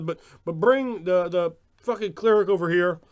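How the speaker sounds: mock player demanding help. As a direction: holler for the cleric to come over. Mocked player demands healing.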